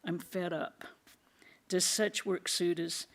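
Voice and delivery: ordinary delivery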